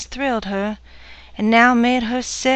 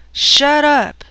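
loud snarled shut up; brutal command; 4 semitones higher, 7 dB louder than usual. Acted. Drunk husband silencing his wife, loud and harsh. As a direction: bark it down at her.

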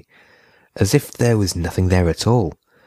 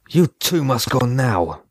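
scolding; 6 semitones higher, slower than usual. A narrator's reading. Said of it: "scolded Bodan".

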